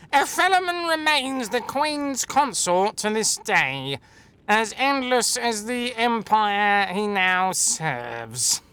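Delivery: high, drawn-out nasal parody; hammed-up nasal warrior impression; nasal Lae'zel. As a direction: read it slowly through the nose, mockingly. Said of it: "Nasal Lae'zel will be like this".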